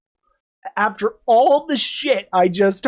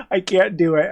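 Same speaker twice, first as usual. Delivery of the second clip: ordinary delivery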